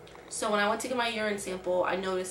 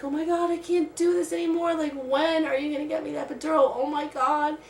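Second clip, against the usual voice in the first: higher and urgent, demanding the epidural; a laboring woman pleads with the nurse, pitched high and frantic; 7 semitones higher, 4 dB louder than usual. Retold or imitated. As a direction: push the nurse for the epidural, at the end of your rope. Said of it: "I'm just like".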